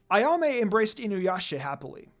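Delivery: usual speaking voice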